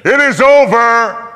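booming, high, slow proclamation; a great cry of completion; loud voice from the throne. A narrator's reading. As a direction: thunder it out from heaven's throne. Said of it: "a loud voice from the throne".

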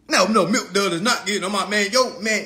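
normal talking voice

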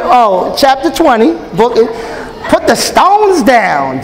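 usual speaking voice

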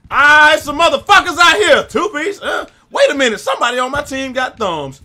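high, loud hyped yelling; excited shouting. Real, not acted. Gamer yelling at enemies during a match. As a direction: shout at the screen mid-fight.

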